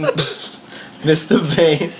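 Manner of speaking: drawn-out very slow repeat; slow deadpan repeat. Retold or imitated. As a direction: stretch it out slow and flat-toned. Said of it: "repeat of the goof".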